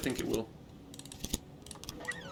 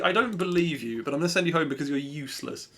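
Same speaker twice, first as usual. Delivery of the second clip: normal talking voice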